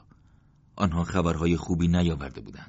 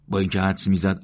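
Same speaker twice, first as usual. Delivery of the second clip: ordinary delivery, nothing marked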